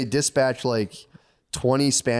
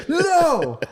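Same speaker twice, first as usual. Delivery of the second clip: high-pitched horrified cry; appalled refusal; 4 dB louder than usual. Retold or imitated. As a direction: act out a shocked refusal, way up high. Retold refusal of human sacrifice.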